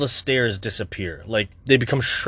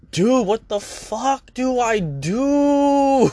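exasperated cry; 11 semitones higher, much slower than usual. Retold or imitated. Vlogger to himself, a high exasperated wail. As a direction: wail it at yourself, lost. Frustrated self-talk, panicking quote.

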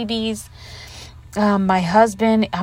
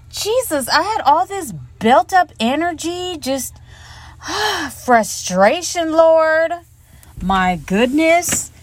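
high, loud burst of pent-up energy; excited exclaiming; 8 semitones higher, 3 dB louder, slower than usual. Real, not acted. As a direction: let it all out, pitched up high. Pent-up energy after reunion.

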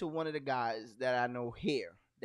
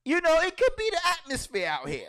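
mock flustered excuse; 8 semitones higher than usual. His mock excuse to friends.

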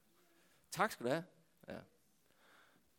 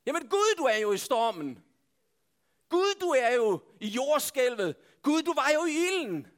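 loud, raised-pitch protest to God; shouting; 6 semitones higher, 4 dB louder than usual. Acted. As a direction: call out each phrase insistently. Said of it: "Undskyld, jeg råber sådan".

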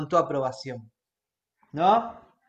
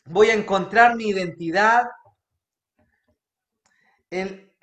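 declaring prayer. Acted. Preacher leads congregation declaring to God, earnest. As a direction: lead the church in a spoken prayer. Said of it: "quiero que declares esto conmigo".

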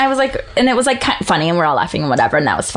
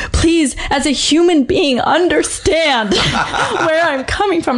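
teary, pleading, half-laughing retelling; tearful plea; 9 semitones higher, slower than usual. Retold or imitated. To the postal clerk, a tearful laughing plea. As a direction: beg through tears, a little laughing. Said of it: "And so I start crying".

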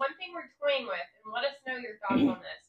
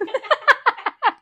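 loud, high burst of laughter; laughing; 14 semitones higher, 15 dB louder than usual. Real, not acted. Cohost cracking up over the podcast's money troubles, laughing. Joke about needing another microphone.

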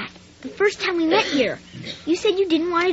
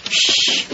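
a quick unvoiced shush; sharp hush; 4 dB louder than usual. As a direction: hush him before anyone hears. Hushing a loud outburst.

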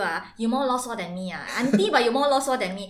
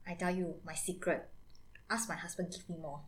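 hushed, confiding auntie; secretive hush; 6 semitones lower, 15 dB quieter than usual. Acted. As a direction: lean in and share a secret. Auntie shares her secret.

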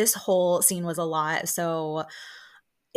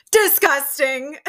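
slow, louder, higher mock disgust; disgusted put-down; 7 semitones higher, 8 dB louder, slower than usual. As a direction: drag out the scorn. Quoting L B's one-word put-down.

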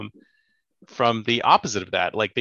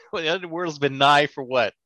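ordinary talk

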